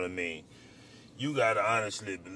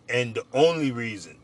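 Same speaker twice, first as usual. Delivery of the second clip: ordinary talk, nothing marked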